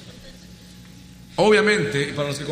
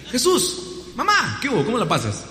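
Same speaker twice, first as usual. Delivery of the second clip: cheery greeting; 8 semitones higher than usual. Retold or imitated. Mary greets Jesus warmly.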